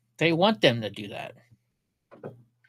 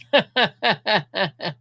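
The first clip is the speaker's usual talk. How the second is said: amused laugh; 3 semitones higher than usual. Real, not acted. Laughing at Porky Pig name.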